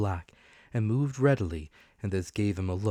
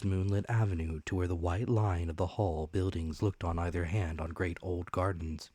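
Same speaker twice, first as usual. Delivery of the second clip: ordinary delivery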